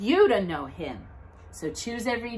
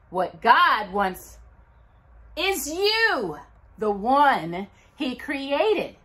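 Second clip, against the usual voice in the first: higher, slowed, drawn-out gift reveal; excited reveal; 7 semitones higher, 6 dB louder, slower than usual. Acted. Host opening box seven for the kids, excited and drawn out. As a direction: build suspense like opening a present for kids.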